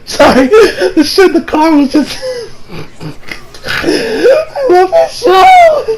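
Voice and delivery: talking through high, wheezy laughter; helpless laughter. Real, not acted. Fan cracking up over the show, real helpless laughter. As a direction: squeeze the words out while cracking up.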